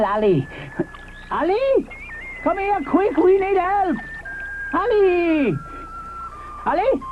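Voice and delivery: high calling, rising panic; panicky Mister Ichthyosaurus. Acted. Mister Ichthyosaurus calls Ally for help, high panicky calling. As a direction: call out for Ally, flustered.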